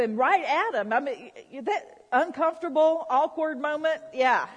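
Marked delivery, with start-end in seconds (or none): none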